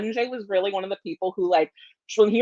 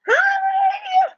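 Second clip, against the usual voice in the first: loud, high burst of a hello; a squealed, shouted hello; excited greeting; 5 dB louder than usual. Real, not acted. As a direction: burst in with a big happy hi. Jury member arrives, greets everyone.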